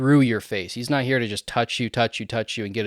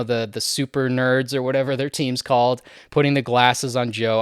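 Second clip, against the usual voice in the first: ordinary delivery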